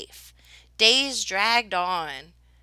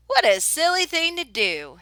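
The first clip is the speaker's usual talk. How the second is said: amused teasing; 7 semitones higher, 5 dB louder than usual. A narrator's reading. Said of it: "he said".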